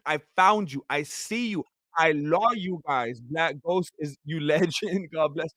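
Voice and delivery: usual speaking voice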